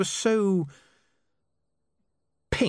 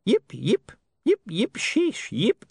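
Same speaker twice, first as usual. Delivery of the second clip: yipping dog; 12 semitones higher, slower than usual. A narrator's reading. Poodle yips and says sheesh.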